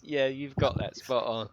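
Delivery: normal talking voice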